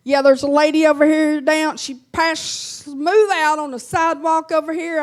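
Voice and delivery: loud, panicked report of a collapse; hammed-up frantic 911 caller; frantic shout.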